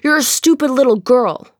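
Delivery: put-on a child's angry taunt; angry boy. A narrator's reading. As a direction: spit it at her like a sulking boy. Boy keeps shouting at Arya.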